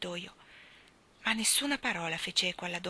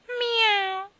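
high, drawn-out cat meow; 8 dB louder than usual. Acted. Cat meows mid-speech.

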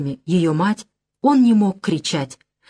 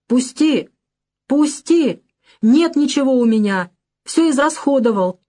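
frightened plea; 6 semitones higher than usual. A narrator's reading. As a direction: beg to be let go. Father struggling, being shaken.

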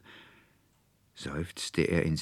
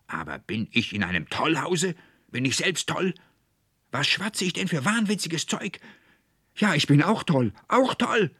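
frantic outburst climbing higher and louder; furious bellow; 12 semitones higher, 6 dB louder than usual. A narrator's reading. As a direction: rage yourself up into a high-pitched shout. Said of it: "brüllte der Konrektor Paulmann in höchster Wut".

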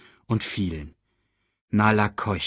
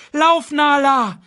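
high, loud, drawn-out yell; urgent shout, pitched way up; roared command; 6 dB louder, slower than usual. A narrator's reading. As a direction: bellow to make them flee. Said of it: "und brüllte".

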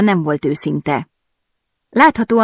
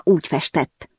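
plain reading voice

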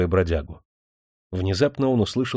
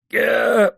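high, loud choked croak; a wheezed gasp as he's struck; wheezing grunt; 6 dB louder than usual. A narrator's reading. Bandit jumped from behind, wheezing grunt. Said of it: "прохрипел татарин".

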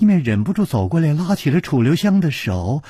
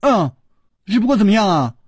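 pitched-up, strained sweet question; forced smile; 7 semitones higher, 3 dB louder than usual. A narrator's reading. Princess pressing the hero to finish, strained cheer. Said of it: "她强笑着说".

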